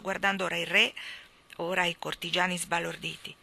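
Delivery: ordinary delivery, nothing marked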